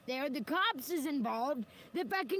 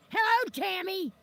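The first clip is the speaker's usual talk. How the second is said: mock loud greeting; 6 semitones higher, 7 dB louder than usual. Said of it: "walked up behind me in a Walmart and goes".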